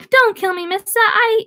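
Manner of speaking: high comic put-on voice; Richie's put-on voice. A narrator's reading. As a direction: squeak it like a frightened kid. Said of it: "doing his pickaninny voice".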